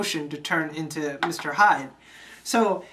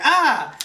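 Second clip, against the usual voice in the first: short, higher acted cry of pain; mock pain yelp; 6 semitones higher, 4 dB louder than usual. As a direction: yelp as if stabbed, playing it for comedy. Retold villain hit by thrown knife.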